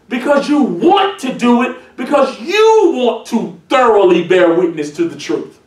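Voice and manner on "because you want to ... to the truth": usual speaking voice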